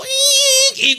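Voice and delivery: high imitated tire screech; screeching brakes. Preacher mimics the brakes in the crash, high screech. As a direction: mimic the car skidding, sharp and high. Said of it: "Pag preno nung kasama ko".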